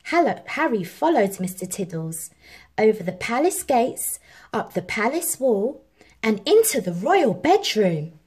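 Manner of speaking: plain reading voice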